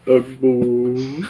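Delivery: lightly voiced old dude, brighter take; old man grumble. Shown as a demonstration. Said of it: "I like playing older dudes".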